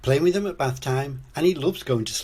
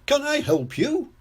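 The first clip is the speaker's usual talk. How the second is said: very high cheery shopkeeper offer; beaming; 3 dB louder than usual. A narrator's reading. Mister Fox offers help to customers, beaming. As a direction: lift it high, eager to serve. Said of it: "beams Mister Fox".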